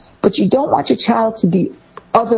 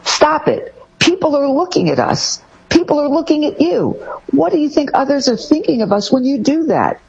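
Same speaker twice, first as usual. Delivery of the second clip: embarrassed scolding parent; 8 semitones higher than usual. Retold or imitated. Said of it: "parent shaming a child in public".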